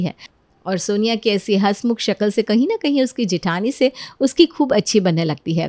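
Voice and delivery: low, even storyteller voice; story narration. A narrator's reading. Narrator tells listeners how the family reacts, calm and low. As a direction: narrate the scene calmly.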